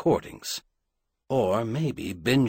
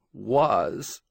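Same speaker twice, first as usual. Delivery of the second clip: a slight quaver on one word; cracked, quavering. A narrator's reading. Correcting herself about her uncle, quavering. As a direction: let the word waver as if in two syllables. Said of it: "came out cracked, so quavering it seemed to have two syllables".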